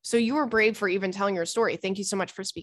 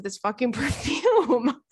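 real laugh bursting through a drawn-out word; 4 semitones higher, slower than usual. Real, not acted. Host reading a listener's perfume story, laughing. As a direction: break into laughter on the words.

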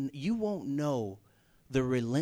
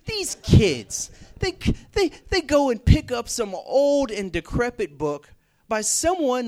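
imitating an indignant rant, loud and much higher; mocking rant; 10 semitones higher, 9 dB louder than usual. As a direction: scoff at the show-offs, full steam. Friend mocks showy book readers.

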